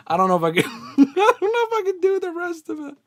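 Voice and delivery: high, breaking laughter over the words; laughing too hard to go on; laughing helplessly. Real, not acted. Host begging off the lyrics, helpless high laughter. Can't finish reading the lyrics.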